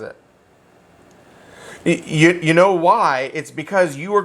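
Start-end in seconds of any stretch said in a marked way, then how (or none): none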